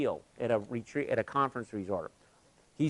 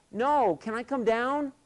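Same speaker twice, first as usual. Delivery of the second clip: put-on a higher, small voice of a frightened kid; imitating scared boy; 11 semitones higher, 4 dB louder, slower than usual. Frightened boy on the cable answers the coach, high and small. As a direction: sound like a boy who wants down.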